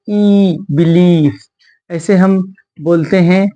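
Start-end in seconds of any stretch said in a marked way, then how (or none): 0.1-1.4 बिलीफ said very slowly with a long ई; stretched ee. Shown as a demonstration. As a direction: draw out the ई in बिलीफ. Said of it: "आई को क्या पढ़े". (बिलीफ में ई को खींचकर धीरे बोलें)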